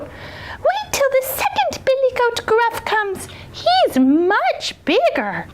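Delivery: high, slow little goat; very small voice. A narrator's reading. Tiniest goat offers the troll a bigger brother, small high voice. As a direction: squeak it slowly, bargaining for your life. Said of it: "in his very small voice".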